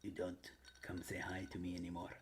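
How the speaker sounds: hushed, whispery mock complaint; whispered put-on accent. Streamer chiding a buddy who stayed away, whispered accent. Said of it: "I don't know why I had that accent".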